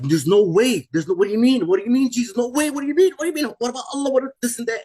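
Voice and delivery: retold panic, pitch climbing very high; frantic disbelief. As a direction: protest wildly in a high, rising voice. Retelling frantic protest while falling.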